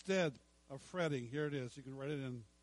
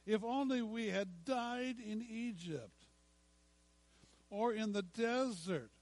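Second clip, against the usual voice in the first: higher, drawn-out whine of the grumbling Israelites; whiny voice; 6 semitones higher, 3 dB louder, slower than usual. Acted. Preacher voicing the complaining Israelites, whiny and slow. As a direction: whine it out slowly, self-pitying. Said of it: "Did I say it in a whiny enough voice?".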